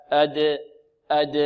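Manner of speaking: أد with a short neutral bounce after the d; qalqalah. Shown as a demonstration. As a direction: echo the د of أد, no vowel. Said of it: "هكذا".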